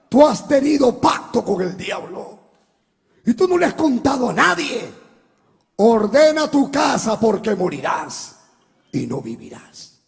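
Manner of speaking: loud, booming divine command; voice of God. Retold or imitated. God's voice telling the sinner he will die, loud and commanding. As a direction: thunder it down from heaven. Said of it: "oí la voz de Dios del cielo".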